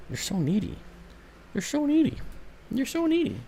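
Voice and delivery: high, soft you're so needy cooed at the dog. Real, not acted. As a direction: coo at the needy puppy. Baby talk to pet.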